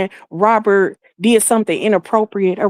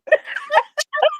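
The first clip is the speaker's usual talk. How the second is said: laughing; 4 dB quieter than usual. Real, not acted. Laughing at the news.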